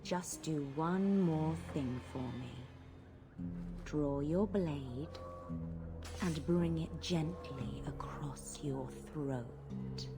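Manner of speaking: slow, hushed spellbinding murmur; hypnotic command. Acted. Blood mage enchanting a victim, hypnotic and slow. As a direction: lull the victim into obeying, word by drawn-out word.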